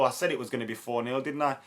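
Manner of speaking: ordinary talk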